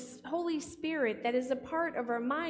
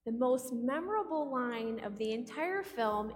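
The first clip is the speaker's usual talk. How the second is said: normal talking voice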